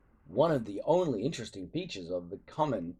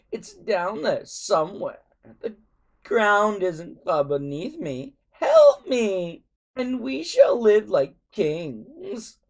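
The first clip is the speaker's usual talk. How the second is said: higher, slow and louder, pleading greed; a villain luring the boys with treasure; desperate tempting Alvin; 8 semitones higher, 7 dB louder, slower than usual. A narrator's reading.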